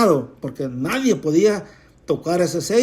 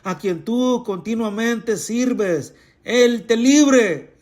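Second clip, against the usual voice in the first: king's cry; 5 semitones higher, much slower than usual. Acted. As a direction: call out slowly as the anguished king. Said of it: "el rey le gritó a Daniel". (grito lento y alto del rey angustiado)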